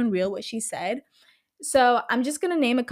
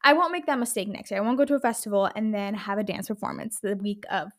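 usual speaking voice

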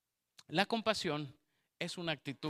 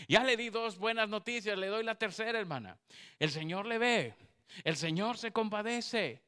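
louder, higher preaching to the women; full-force preaching; 7 semitones higher, 6 dB louder than usual. Acted. Preacher to the women in church, full-force preaching. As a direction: proclaim it from the pulpit.

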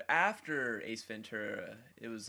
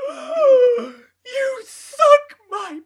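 shrieked, very high and loud through laughter; Jim Carrey impression; 22 dB louder, much slower than usual. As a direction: scream it like a cartoon meltdown. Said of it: "the big line from that movie".

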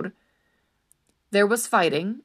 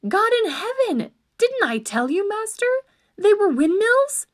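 exasperated cry; 13 semitones higher, 5 dB louder than usual. Acted. Squire scolds fallen knight.

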